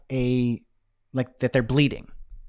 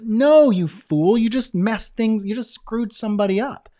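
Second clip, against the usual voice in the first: mock scolding; 7 semitones higher, 4 dB louder, slower than usual. Presenter scolds the timid paramedic.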